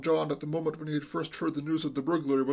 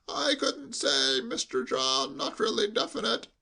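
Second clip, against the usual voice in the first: high, slow, quavering sheep-like bleat; an old servant bleating every word; bleating old butler; 7 semitones higher, slower than usual. Acted. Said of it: "bleated like a sheep".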